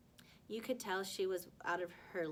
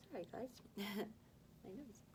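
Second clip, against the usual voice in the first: plain reading voice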